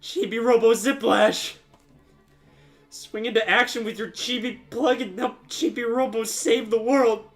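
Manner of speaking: ordinary delivery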